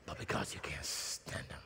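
breathy whisper, full of disdain; whispered contempt. Acted. Preacher whispering how you secretly feel about someone. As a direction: spit it out under your breath.

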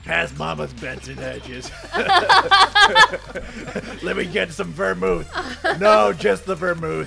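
put-on slow, laughing old-timey vamp; hammed-up retro vamp, breaking into laughs. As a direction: purr like a boozy fifties housewife. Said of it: "like, sexy, like, Lucille Ball voice".

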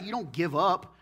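ordinary talk

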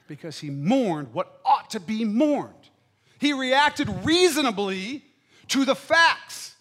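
slow, loud, deliberate preaching; emphatic preaching. Acted. Lay each word down, slow and loud. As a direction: pastor explaining why the tax collector was justified, slow emphatic preaching.

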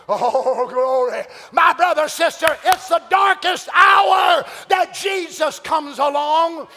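loud, slow shouted exhortation; fiery sermon climax; full-force preaching. Real, not acted. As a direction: preach it to the back pew.

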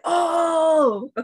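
a loud, high burst of sudden understanding; surprised oh. Real, not acted. Realizing what the client meant, a loud surprised oh. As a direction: let it hit you all at once, out loud.

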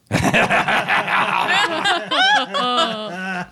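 high, long burst of mock laughter; crowd laughing. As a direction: laugh like a whole crowd at a joke. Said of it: "And they all go".

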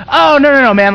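loud, high, casual protest from the guy; mock protest. San Diego guy waves off the pastor, loud casual protest. Retelling a guy's casual reply.

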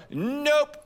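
high, clipped "Nope"; body saying no to the instructor; flat refusal. Retold or imitated. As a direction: refuse the pose outright. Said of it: "I literally would go".

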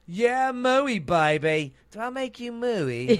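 high, loud, hammed-up English accent; randy English swinger as a cow; Austin Powers. Doing celebrity cows, flirty loud swinger impression. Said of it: "And an Austin Powers cow".

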